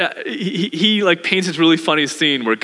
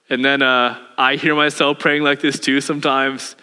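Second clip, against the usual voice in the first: ordinary talk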